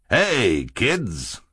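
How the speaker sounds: chubby man's lightly boomed greeting; a touch of a booming hail; mild booming voice. A narrator's reading. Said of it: "said in a loud, booming voice".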